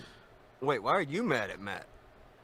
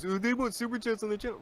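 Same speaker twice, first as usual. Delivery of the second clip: mocking whiny critic; 11 semitones higher, 3 dB quieter than usual. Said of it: "that's always their excuse".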